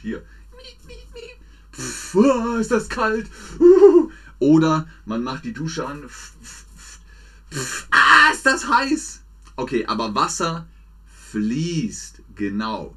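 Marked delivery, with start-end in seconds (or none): 1.7-4.1 high yelps of a mock cold-shower shock. 6.1-9.2 puffing, then a high loud mock cry; hammed-up yelp at hot water; hot shower shock.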